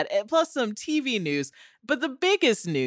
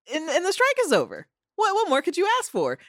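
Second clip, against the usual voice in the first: high-pitched, excited rejoicing; real elation, voice riding high; giddy celebration; 9 semitones higher than usual. Real, not acted. Celebrating the end of the strike.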